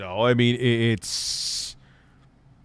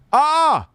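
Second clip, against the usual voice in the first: frustrated yell; 7 dB louder than usual. Real, not acted. Exasperated outburst mid-rant.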